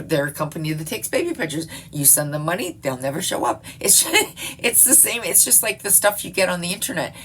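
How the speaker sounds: usual speaking voice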